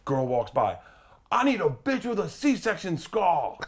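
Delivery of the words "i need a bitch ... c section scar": high, drawn-out celebrity holler; outrageous yell. Retold or imitated. Comic retells Tracy's outburst as a girl walks by, outrageous yell. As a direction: imitate a star hollering slowly across the club. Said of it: "he yells something outrageous".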